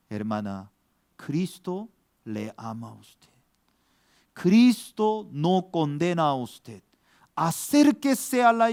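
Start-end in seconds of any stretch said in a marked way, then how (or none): 0.1-3.3 tender consoling. Retold or imitated. Pastor consoling weeping woman.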